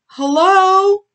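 high, loud sing-out hello; calling out. A narrator's reading. Young pig Olivia to the hidden newcomer, calling out. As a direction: call out to someone hidden, high and drawn out. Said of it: "Olivia called out".

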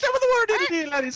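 outdo the last one's Irish hello; mock Irish greeting. Copying the Irish greeting intro.

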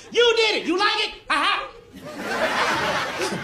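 high, sarcastic mock praise; fake-admire her hair to mock her. Boyfriend mocking his girlfriend's new hair, high and sarcastic.

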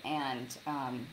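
ordinary delivery

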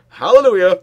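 loud, high, joyful cry; excited shout. Real, not acted. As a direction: cheer it out in relief. Escaping danger in game.

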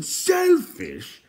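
high, loud shriek of outrage; outraged shout. A narrator's reading. King throwing Eilonwy's insult back, outraged high shout. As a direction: shout it back in disbelief, pitch leaping. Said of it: "shouted Edeleg, his eyes popping".

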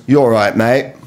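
casual London "You all right, mate?"; easy Cockney greeting from the newsagent; light London newsagent hello; London accent. Retold or imitated. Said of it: "it was".

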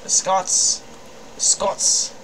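tentative Scottish accent, nearly whispered. Shown as a demonstration. Player warming up for a character, tentative Scottish accent. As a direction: try out a Scottish accent under your breath. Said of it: "Maybe I should do a Scottish voice".

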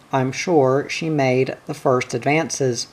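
plain reading voice

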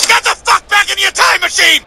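high furious yell; shrieking order to leave now; angry yell. Acted. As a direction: scream at him to get back. Future self bursts in yelling.

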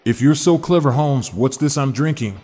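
normal talking voice

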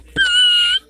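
very high-pitched mimicked squeal; imitated squeal. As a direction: squeal it in imitation of another player. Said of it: "is what she said".

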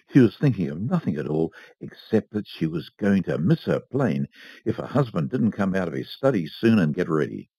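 ordinary delivery

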